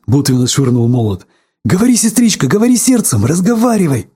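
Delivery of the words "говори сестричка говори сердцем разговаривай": high, eager pleading; excited urging. A narrator's reading. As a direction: urge her on excitedly in a raised pitch. Excited urging after the first sound. (взволнованно, высоко, уговаривая)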